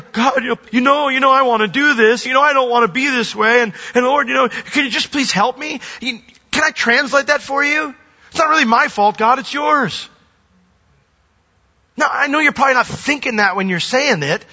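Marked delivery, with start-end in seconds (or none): 0.1-10.1 whiny mock confession.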